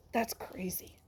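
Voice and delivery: soft breathy whisper; whispered awe. Real, not acted. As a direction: hush it out in amazement. Awed by shark teeth.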